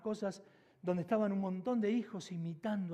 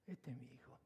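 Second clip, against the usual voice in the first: unvoiced, breathy whisper, much softer and lower; 6 semitones lower, 13 dB quieter than usual. Shown as a demonstration. Pastor repeating the father's pride to the congregation in a whisper. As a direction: let the father's words fall to a hush.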